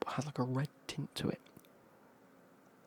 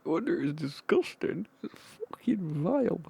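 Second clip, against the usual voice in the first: exaggerated disgust; 6 semitones higher, 6 dB louder than usual. Friends learning the shot was a laxative, loudly disgusted.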